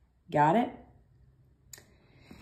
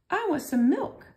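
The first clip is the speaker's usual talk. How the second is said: high pretend student; 11 semitones higher than usual. Teacher voicing a student asking for fish, pitched high. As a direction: pretend request, pitched much higher. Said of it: "how to say some".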